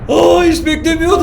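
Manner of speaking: high, loud and slow cry of surprise; startled shout. Real, not acted. Jolted by the sudden attacker, a startled high shout. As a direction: shout it as if caught off guard. Jump scare while watching.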